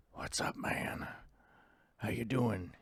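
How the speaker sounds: whispered greeting. Real, not acted. Host whispering hello to a viewer in chat. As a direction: breathe the words unvoiced.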